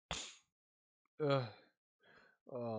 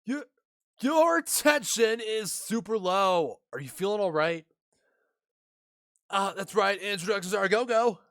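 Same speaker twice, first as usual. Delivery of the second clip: higher and louder, bright greeting; peppy girl voice; 7 semitones higher, 6 dB louder than usual. Acted. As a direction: bounce in with a cheery hello. Same peppy girl continues.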